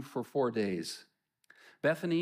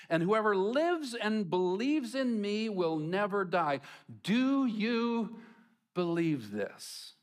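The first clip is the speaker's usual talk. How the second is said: proclaiming Jesus; 6 semitones higher, slower than usual. Acted. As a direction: proclaim it like a revelation. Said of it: "And Jesus said to her".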